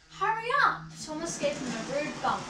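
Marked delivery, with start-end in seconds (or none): 0.1-0.8 impatient call. A narrator's reading. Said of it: "said the coaches".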